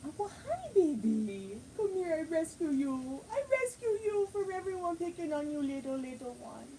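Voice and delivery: high, sweet cooing pet voice. Real, not acted. Baby talk to rescued dog.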